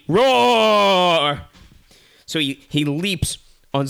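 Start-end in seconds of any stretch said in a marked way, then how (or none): 0.1-1.4 loud, high, long drawn-out roar; attack roar. Acted. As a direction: roar long before pouncing. Bear roars before leaping.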